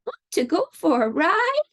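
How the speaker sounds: high horse voice, rising question. Acted. Horse invites the spider for a ride, high animal voice. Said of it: "said the horse".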